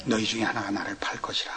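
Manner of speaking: Jesus whispering the betrayal to his disciples; hushed Jesus. Retold or imitated. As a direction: breathe the words like a secret at the last supper. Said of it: "말씀하시기를".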